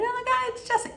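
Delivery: very high squealing delight; imitating excited guys. The guys thrilled to see Justin arrive, excited. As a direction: gush like you can't believe it. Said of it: "happy and excited".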